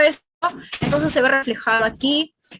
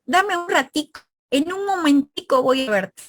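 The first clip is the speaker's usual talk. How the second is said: Cuban-style casual phrasing, pitch lifted high; Cuban speech; 4 semitones higher than usual. Shown as a demonstration. Teacher imitating how Cubans say ratico, a light Cuban lilt. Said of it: "en Cuba, qué dicen ellos".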